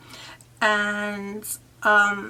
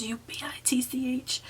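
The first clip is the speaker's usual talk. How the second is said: hushed spelling; 4 semitones higher, 3 dB quieter than usual. Retold or imitated. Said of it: "he cursed at me".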